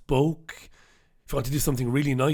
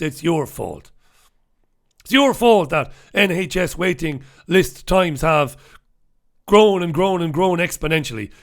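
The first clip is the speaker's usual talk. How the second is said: mock establishment rant. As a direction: hammer out the blame at old people. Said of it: "That's the establishment approach to senior citizens".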